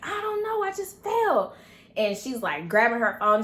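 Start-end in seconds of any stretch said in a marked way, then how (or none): 0.0-1.5 re-enacted high-pitched wail; distressed answer. Daughter on the floor answers mom, high distressed wail. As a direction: wail it back helplessly.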